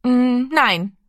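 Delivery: put-on small high boy's no after a hum; hesitate, then dare to refuse; hesitant refusal. A narrator's reading. Little Rami finds courage to answer his big brother, hesitant. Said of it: "woher er den Mut hatte zu antworten".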